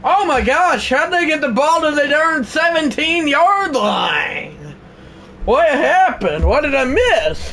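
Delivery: high, loud, excited disbelief; astonished outburst. Real, not acted. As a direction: burst out amazed at the play. Startled by a big play.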